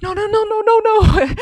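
high, loud mock panic; retold panic going over a waterfall; panicked protest. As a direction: cry no as you go over the falls. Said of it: "And I'm like".

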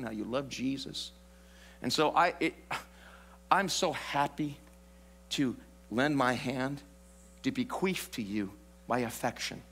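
hammed-up tender devotion; mock sweet husband. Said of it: "since I'm a godly man".

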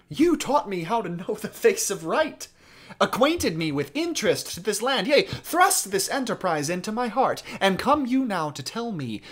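high, pressing stage indignation; Louis the Dauphin. Acted. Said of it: "to push and to shove and to demand".

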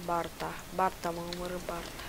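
ordinary talk, nothing marked

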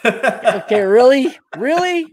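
high, loud mock indignation; mock outrage. Real, not acted. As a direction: play wounded at the joke. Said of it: "say it fast".

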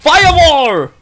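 high loud battle cry; spell-casting shout. Real, not acted. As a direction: roar the spell name.

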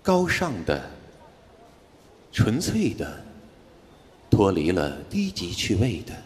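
slow, solemn poem recital, dropping low; recitation tone. Acted. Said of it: "听这个带朗诵腔的啊".

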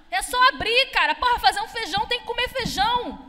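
normal talking voice

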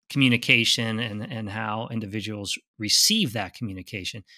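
ordinary talk, nothing marked

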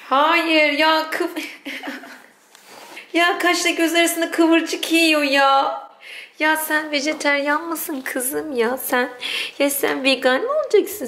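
mock scolding pet. Real, not acted. Scolding pet eating lettuce.

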